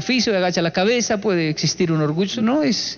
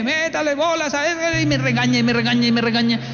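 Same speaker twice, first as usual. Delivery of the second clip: bossy farm owner, pitched up and quick; mocking the boss; 7 semitones higher than usual. Retelling how the farm boss scolded him, mock bossy voice. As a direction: mimic the scolding boss with a nasal bite.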